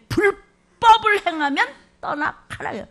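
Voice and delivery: slow, loud, high commanding voice of judgment; stern divine rebuke. Acted. Preacher voicing God, slow loud rebuke. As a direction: boom it out as God's own verdict.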